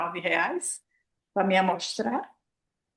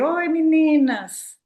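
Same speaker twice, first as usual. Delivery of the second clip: slow, sing-song "Oi, meninas" in a louder, higher voice; childish greeting; 6 semitones higher, 6 dB louder, much slower than usual. Retold or imitated. Greeting the women like little girls, sing-song. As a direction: coo it like a playground game. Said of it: "brincadeirinha de criança".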